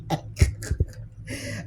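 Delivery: breathy unvoiced laugh; quiet real laughter. Real, not acted. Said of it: "I'm laughing".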